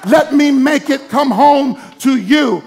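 fiery pulpit shouting; preaching at full force. Acted. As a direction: build the congregation to a roar.

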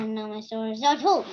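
rushing through the last line; fast reading. Acted. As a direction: rattle it off quickly. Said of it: "I like saying it fast".

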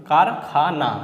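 কার, খা, না as three separate beats; firm, split reading of কার, খা, না; broken into syllables. Shown as a demonstration. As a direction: sound out কার, then খা, then না. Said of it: "ভেঙে ভেঙে উচ্চারণ করে".

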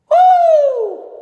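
loud high whoop into the stairwell echo. Shown as a demonstration. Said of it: "do you wanna hear the echo in here?".

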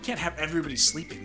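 normal talking voice

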